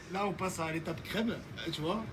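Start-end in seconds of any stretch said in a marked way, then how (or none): none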